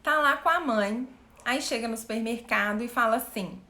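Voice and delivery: ordinary delivery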